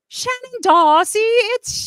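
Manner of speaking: high, drawn-out mock British announcement; British accent. Host introducing her guest in a playful British accent. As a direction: call it out in an excited put-on British accent. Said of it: "That was a good accent".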